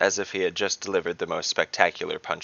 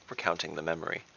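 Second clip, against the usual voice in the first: ordinary delivery, nothing marked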